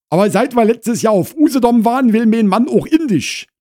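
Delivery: high, loud housewife chatter; Frau Krause confiding loudly. Acted. Wife tells neighbour of husband's holiday fad, high and loud.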